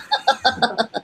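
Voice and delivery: short high nervous laugh; high embarrassed laugh. Real, not acted. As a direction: laugh it off, a bit awkward.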